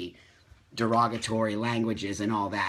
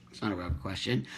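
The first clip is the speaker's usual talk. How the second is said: normal talking voice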